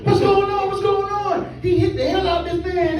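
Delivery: high, shouted panic of the passengers; high-pitched frantic crowd yell; panicked bus riders. Retold or imitated. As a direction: yell it like the whole bus is losing it. Bus riders yelling in shock.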